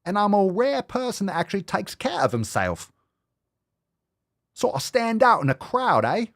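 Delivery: lampoon a preening gym guy; mocking impression. Mocking a smug influencer for the camera, high voice.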